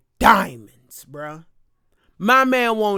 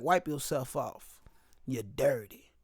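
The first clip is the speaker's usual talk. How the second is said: dismissive doctor; 8 semitones lower, 10 dB quieter than usual. Retold or imitated.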